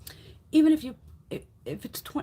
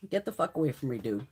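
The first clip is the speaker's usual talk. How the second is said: ordinary delivery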